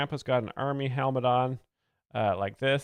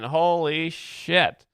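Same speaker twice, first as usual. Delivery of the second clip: amazed; 5 semitones higher, 6 dB louder, much slower than usual. Real, not acted. Reacting to crowd going wild.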